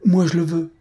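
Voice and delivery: put-on girl crying out for the donkey; exclaiming. A narrator's reading. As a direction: blurt it out like a girl who wants it now. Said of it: "Frosine s'écriait".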